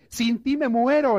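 high, slow, hammed-up pining; mock lovesick lover. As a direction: swoon it out like a soap opera lover. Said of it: "le dice uno al otro".

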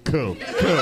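ordinary delivery

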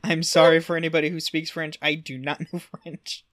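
real laugh in the voice; laughing apology. Real, not acted. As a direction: apologize to French speakers through laughter. Laughing apology about bad French.

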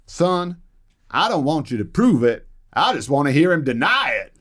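a hint of a sly Texan boss; Johnson's punchline. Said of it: "looks at them and says".

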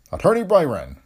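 calling out. A narrator's reading. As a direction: call the name out loud and high. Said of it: "Judge Fowler called".

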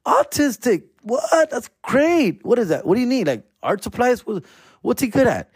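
high, thrilled dad gushing over the news; mock excited. Dad cheering his son's diagnosis, mock excited and high. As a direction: act delighted, pitch way up. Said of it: "And I'm like".

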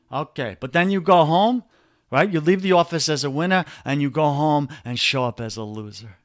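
ordinary talk

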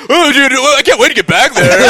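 high, loud gushing; hammed-up eager druggie buddy; acted giddy eagerness. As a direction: gush like you've forgotten all the danger. Acting the giddy relapse minutes later.

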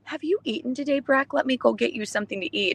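sweet mothering friend voice, imitated; caring friend. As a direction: play the doting friend offering food. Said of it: "look up and be like".

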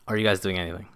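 ordinary delivery

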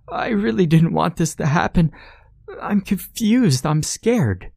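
put-on high, pleading child's voice; a frightened boy protesting; scared little boy. A narrator's reading. Johnny pleads with Mommy, high scared child.

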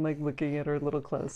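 normal talking voice

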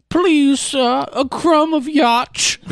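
high, slow pleading beggar; begging beggar voice. Acted. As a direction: plead high like a starving orphan. Begging for a yacht crumb.

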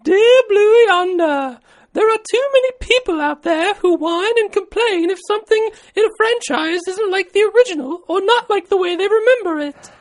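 sustained, very high falsetto letter reading; man putting on a high lady voice; British lady voice. Acted. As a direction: read a letter as a prim falsetto British woman. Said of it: "How's your lady voice, Jim?".

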